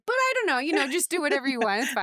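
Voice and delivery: high, fast, dismissive whine; mock-casual "whatever you want"; passive-aggressive. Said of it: "You need to finish it up with".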